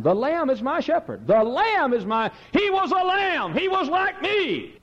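high, slow ringing pulpit cry; preacher quoting John in rapture; full-force preaching. Acted. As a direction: stretch out every word in fervour. Preaching climax.

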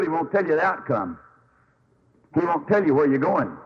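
normal talking voice

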